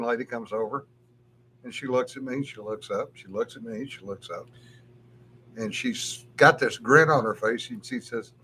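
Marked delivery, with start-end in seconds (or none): none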